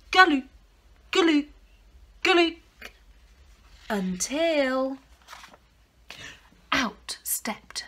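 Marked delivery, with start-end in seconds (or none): none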